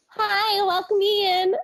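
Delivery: high, drawn-out teasing Southern welcome in; mock Southern greeting. Coworker mocking her Southern greeting, high twang. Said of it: "one of my associates likes to make".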